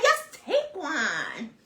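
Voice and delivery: very drawn-out, laughing urging; retold coaxing. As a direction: stretch each word, coaxing through a laugh. Friend's coaxing acted out.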